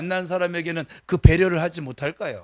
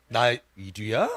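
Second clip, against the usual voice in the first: confident swagger; 8 semitones lower, slower than usual. Retold or imitated. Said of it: "당당하게".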